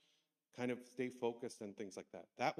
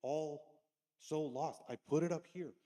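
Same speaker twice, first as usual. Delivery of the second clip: raised, exasperated voice at the class; frustrated teacher; 4 semitones higher, 5 dB louder than usual. Retold or imitated. Teacher to lost students, frustrated and loud. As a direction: scold the lost kids, higher and louder. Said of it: "and I got frustrated".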